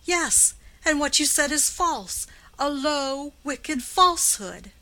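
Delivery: high, loud, biting outburst; wife lashing back at her sneering husband. Acted. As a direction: flash out at him, furious and cutting. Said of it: "she said in a biting tone".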